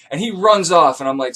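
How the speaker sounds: usual speaking voice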